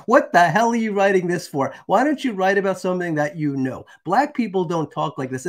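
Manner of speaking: high-pitched indignant scolding; mock outraged dressing-down; retold telling-off. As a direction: act out a woman laying into someone. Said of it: "she went off on him".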